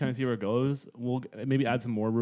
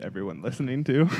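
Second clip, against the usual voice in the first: ordinary talk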